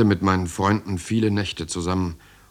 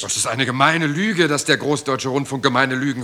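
higher and louder, protesting hotly; indignant raised voice defending himself; indignant outburst; 6 semitones higher, 6 dB louder than usual. Acted. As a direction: fire back at the accusation. Heated quarrel with boss.

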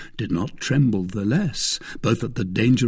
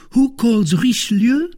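high, louder, deliberate denunciation; vehement cry; 6 dB louder, slower than usual. A narrator's reading. Young woman denouncing Richelieu to her husband, vehement. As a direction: declare it with fierce conviction. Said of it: "cried the young woman".

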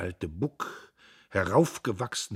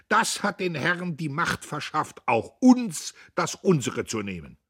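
loud, high-pitched rousing speech; swelling oratory; 11 semitones higher, 8 dB louder than usual. A narrator's reading. Old Buck appeals to fellow citizens, impassioned and swelling. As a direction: raise the voice to carry the hall. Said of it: "die anschwoll und erwärmte".

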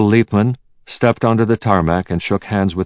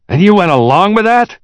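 rising outrage; 14 semitones higher, 6 dB louder than usual. A narrator's reading. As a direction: voice climbing, loud and very high. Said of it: "his voice rising with every word".

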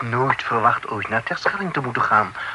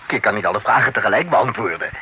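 rattled off fast; 5 semitones lower, much faster than usual. Acted. Flooded with questions, exasperated.

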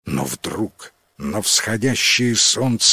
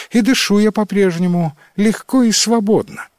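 голос Делмы; 9 semitones higher, 4 dB louder than usual. A narrator's reading. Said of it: "ответила Делма".

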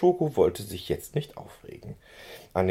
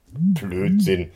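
louder, indignant snap of a word; storybook pony fuming at the mare; angry outburst; 6 dB louder than usual. A narrator's reading. Schoko rejects the mare's taunt, angry louder retort. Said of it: "wütete Schoko".

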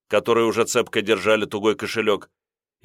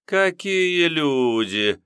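высоко и протяжно, нараспев; пропеть радостно, растягивая слова; 7 semitones higher, much slower than usual. A narrator's reading. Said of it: "нараспев произнесла она". (нараспев, как обрадованная кондукторша)

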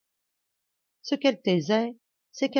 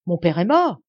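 alarmed; 8 dB louder than usual. Acted. Daughter fears father dead.

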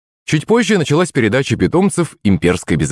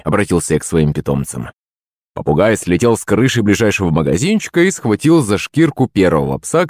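ordinary delivery, nothing marked

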